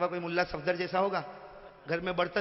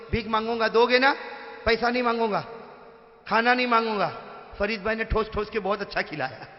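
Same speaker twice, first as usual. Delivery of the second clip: high, loud preacher calling on the crowd; loud crowd appeal; 5 semitones higher, 13 dB louder than usual. Acted. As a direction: rouse the audience to answer. (مجمع کو جوش سے پکارتا خطیب)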